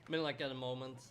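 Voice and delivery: ordinary talk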